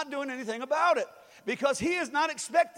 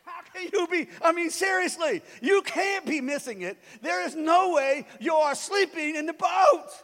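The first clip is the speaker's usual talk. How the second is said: high, building shout of disbelief; exasperated yelling; 5 semitones higher, 5 dB louder than usual. Acted. Aggravated believer to sleeping Jesus, exasperated high shout. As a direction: plead loudly, stretching it into a final yell.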